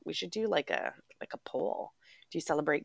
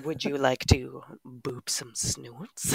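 slow, seductive crooner voice; 5 semitones lower, slower than usual. Pretend. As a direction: croon it like a love ballad.

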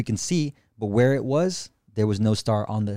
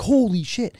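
much higher awed exclaim; amazed; 11 semitones higher, 3 dB louder, slower than usual. Retold or imitated. Watching the UFO leap, high amazed exclaim. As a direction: cry out in amazement. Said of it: "I'm like".

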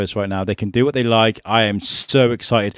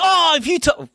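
put-on very high, childish taunting voice; squeaky kid brother threatening; bratty little brother. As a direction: play a cocky kid hiding behind his big brothers. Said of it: "like that kid that's got the older brother".

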